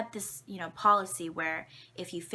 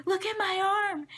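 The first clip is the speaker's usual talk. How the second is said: much higher, louder alarmed cry; panicked plea; 12 semitones higher, 6 dB louder than usual. Retold or imitated. Child runs to the teacher showing her broken arm, high and loud. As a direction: run up and show the injury in alarm. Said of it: "and I'm like".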